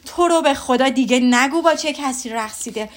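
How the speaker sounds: husband begging his wife to stop, exasperated yell; exasperated shout. Acted. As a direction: cry out to make her stop. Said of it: "از فرد استیصال داد زد".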